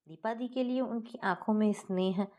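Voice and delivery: plain reading voice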